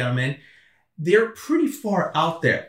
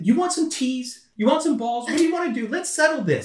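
high-pitched, pleading exasperation; worked-up, pitched-up bargaining; exasperated retold offer; 8 semitones higher than usual. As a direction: act out trying to settle a quarrel. Retold argument with angry golfers.

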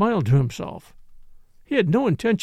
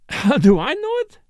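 put-on higher, louder accented comeback; incredulous retort; 9 semitones higher, 5 dB louder than usual. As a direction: throw the question back, worked up. Morel scornfully echoes the question.

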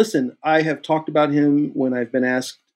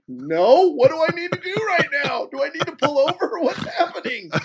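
mock-panicked high squeal; high-pitched, hammed-up alarm; retold panic. Actor reacting to his agent's warning, mock panic.

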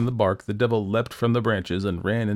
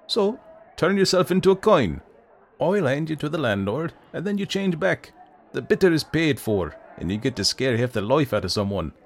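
raised, cocky Irish-tinged rogue; Jack voice; 7 semitones higher, 3 dB louder than usual. A narrator's reading. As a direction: play the scheming drinker.

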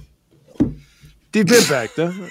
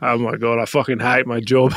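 much lower, defeated clerk groan; low, fed-up worker at the counter; exasperated groan; 7 semitones lower, 3 dB quieter than usual. Acted. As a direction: sink into despair at the job.

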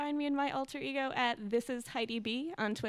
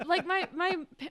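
ordinary talk, nothing marked

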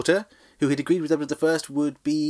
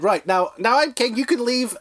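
Balliol dismissing; 10 semitones higher, 3 dB louder than usual. Retold or imitated. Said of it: "and said".

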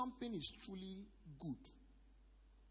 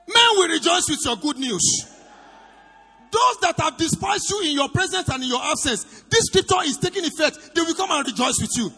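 loud, high-pitched sermon shout; full-force preaching; 8 semitones higher, 23 dB louder than usual. Real, not acted. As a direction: proclaim it to the whole congregation at full power.

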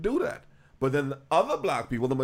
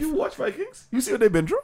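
excited; 12 semitones higher, 3 dB louder than usual. Real, not acted. Excited Vikings fan rant.

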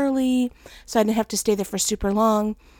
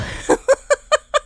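high laugh. Real, not acted. Laughing at herself.

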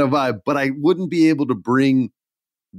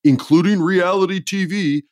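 retort; 6 semitones higher, 4 dB louder than usual. Retold or imitated. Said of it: "I'm like".

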